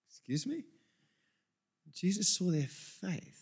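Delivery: a quiet puzzled double take; mock surprise. Shown as a demonstration.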